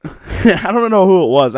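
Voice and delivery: normal talking voice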